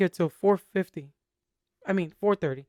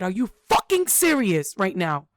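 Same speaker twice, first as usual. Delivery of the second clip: much higher, louder retold yell at her son; 7 semitones higher, 4 dB louder than usual. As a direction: relive the frustrated shout at the kid. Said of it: "I yelled and I said".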